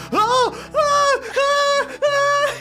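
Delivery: string of very high startled yelps; high panicked shrieks. Acted. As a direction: squeal in cartoonish panic. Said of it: "he goes".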